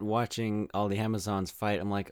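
normal talking voice

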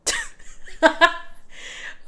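real burst of high laughter; amused laugh. Real, not acted. Storyteller laughing at remembering the talk with dad, amused laugh. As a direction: crack up mid-story. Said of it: "I'm sorry for laughing".

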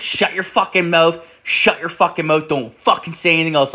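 high-pitched mock telling-off; scolding girlfriend. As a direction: mimic a girlfriend cutting him off crossly. Girlfriend scolds him on the phone.

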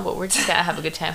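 ordinary delivery

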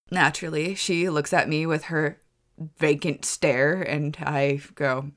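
ordinary talk, nothing marked

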